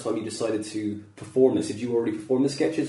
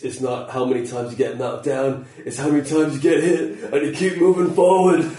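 Rocky; 2 semitones higher, 4 dB louder than usual. Acted. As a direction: deliver a cheesy motivational film line, building to a big finish. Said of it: "really cheesy line now from Rocky".

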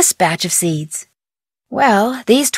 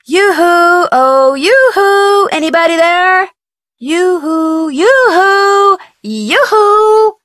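high, louder sing-song yoo-hoos; calling out; 9 semitones higher, 3 dB louder, slower than usual. Real, not acted. Calling over the CB radio for anyone, high sing-song yoo-hoo. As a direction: call out hoping someone answers.

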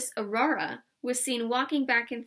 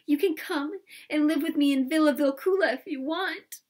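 higher, louder offer of comfort; comforting, in a raised voice; tearful comforting; 5 semitones higher, 5 dB louder than usual. Acted. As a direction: console her while still upset. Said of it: "said Pippi comfortingly".

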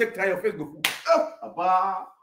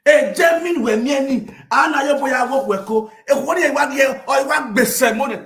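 loud shout; 6 semitones higher, 7 dB louder than usual. Acted.

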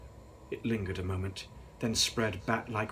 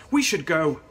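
put-on urgent girl voice; 6 semitones higher, 10 dB louder than usual. A narrator's reading.

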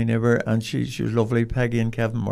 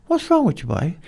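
a much higher mock mother, puzzled; mother's voice; 9 semitones higher than usual. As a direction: lightly play the puzzled mother.

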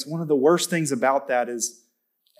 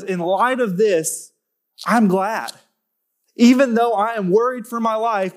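preaching at full force; 4 semitones higher than usual. Acted. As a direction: lift the room with rising conviction.

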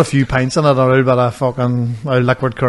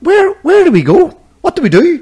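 high-pitched, louder mock bewilderment; exasperated retort; 5 dB louder than usual. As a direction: throw the questions back, voice shooting up. Exasperated retort retold to couple.

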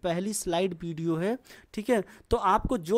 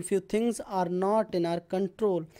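slow model sentence; much slower than usual. Shown as a demonstration. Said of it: "कुछ चीज़ें हमारे बस में नहीं होती".

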